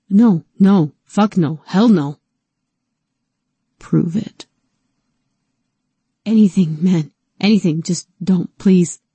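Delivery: loud, panicked begging; desperate pleading. Acted. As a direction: plead frantically for your life. T begs Erkan for his life.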